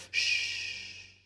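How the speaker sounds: calm the creature; soothing shush. A narrator's reading. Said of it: "Erica said soothingly".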